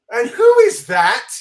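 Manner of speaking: high, loud and slow, leaning hard on who; AND WHO is that, stretched out; suspicious who. Retold or imitated. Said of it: "She goes".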